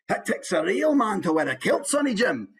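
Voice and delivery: high-pitched, hearty Scottish accent; broad Scottish key phrase, pitched high. Shown as a demonstration. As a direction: play it as a full Scottish character. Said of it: "let's take Scottish, for example".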